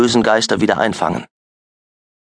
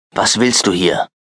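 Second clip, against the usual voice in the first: gruff; 2 semitones lower than usual. A narrator's reading. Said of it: "fragte er schroff".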